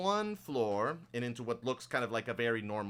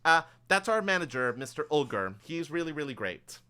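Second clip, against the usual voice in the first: lightly voiced elf, pitched up and louder; silver-haired elf assistant; 5 semitones higher, 7 dB louder than usual. Elf assistant pointing out her manager to customers, lightly voiced and enthusiastic. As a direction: introduce the boss brightly, a touch higher. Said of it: "silver-haired elf that you're with will kind of go".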